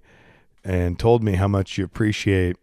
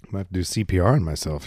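ordinary talk

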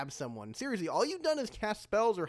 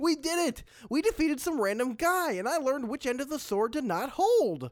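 high, elated cheer; triumphant; 7 semitones higher than usual. Acted. Fighter celebrating victory with friend, triumphant. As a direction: crow over the win, giddy.

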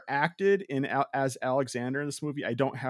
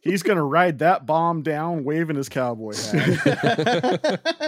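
laughing; 2 semitones higher, 4 dB louder, slower than usual. Real, not acted. Joking image of hammy actor.

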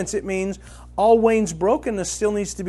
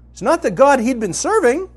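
ordinary talk

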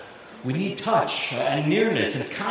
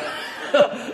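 breathy laugh; 8 semitones higher than usual. Real, not acted. Laughing at own joke.

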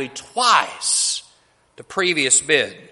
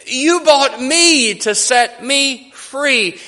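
higher, louder and slow, overwhelmed with tears; tearful outburst; 7 semitones higher, 4 dB louder, slower than usual. Acted. Kneeling girl to the miner, tearful overwhelmed cry. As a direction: let it break out as the truth hits her. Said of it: "tears streaming down her face".

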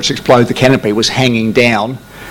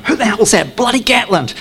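higher, mock-exasperated tower voice; exasperated controllers; 10 semitones higher than usual. Controllers cursing the pilot who took off, higher exasperated voice. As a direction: play the annoyed controllers cursing him. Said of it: "I see them going".

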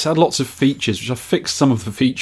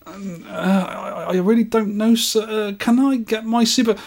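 high, hesitant, stammering assistant; flustered sales assistant; 10 semitones higher than usual. Acted. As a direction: nervously look for a way out. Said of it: "Him to sales assistant".

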